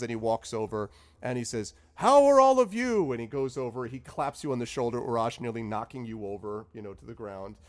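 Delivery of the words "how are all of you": delighted wizard greeting. Acted. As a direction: open your arms to old friends. Zephyros greeting party.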